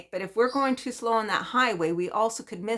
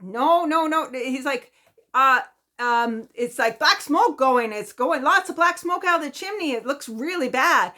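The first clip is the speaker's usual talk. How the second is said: neighbor characterization; 6 semitones higher, 6 dB louder than usual. Retold or imitated. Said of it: "I'll try to do a little characterization".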